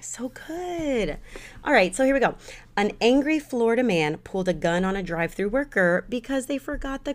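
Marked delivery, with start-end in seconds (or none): 0.0-1.2 whispered with relish. Real, not acted. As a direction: breathe it out like a secret. Confessing love for strawberry cream cheese.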